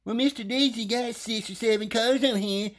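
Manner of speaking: high mocking voice of a snippy phone agent; mocking female agent. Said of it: "the dumb bitch I'd been dealing with said".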